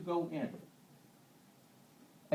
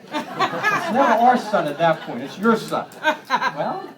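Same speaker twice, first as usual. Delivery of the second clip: laughing punchline; 7 semitones higher, 10 dB louder, slower than usual. Real, not acted. Laughing punchline about family blame.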